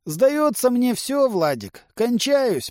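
high, strained dying man's voice, not whispered; ailing uncle. A narrator's reading. Sick uncle telling Vladik he is finished, strained. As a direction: draw it out high, as if fading. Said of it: "прошептал дядя Саша".

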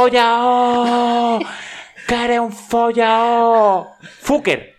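high, loud, long-held yell; hammed-up prank-call holler, vowels stretched out; imitated drunken bellowing. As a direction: bellow it down the phone like a drunken lout. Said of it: "se escucha un berraco".

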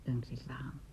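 breathy whispered words; softly whispered, almost inaudible. Acted. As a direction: whisper it close to the ear. Hushed intimate moment.

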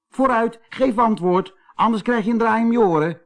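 high, hurried storybook scolding; indignant threat. A narrator's reading. Kees threatens the silent doll, high and indignant. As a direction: demand an answer, pitched well up.

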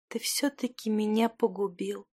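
soft, faint dying voice; quietly exhaled. A narrator's reading. Dying Stekhana reproaches him, quietly breathing it out. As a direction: let it out on a weak breath. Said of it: "выдыхала тихо она".

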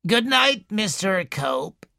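put-on slow, wheezy old woman's voice; slow wheezing invalid complaining to a visitor; wheezing old lady. A narrator's reading. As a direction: wheeze it out like a sickly matriarch. Mrs Boynton's voice.

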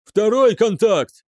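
high, loud urgent cry; yelled alert. A narrator's reading. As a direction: shout a further sighting. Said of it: "заорал отслеживающий офицер". (орёт тревогу)

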